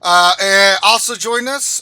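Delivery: loud, high hype intro; hype announcer. Pretend. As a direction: build the crowd up.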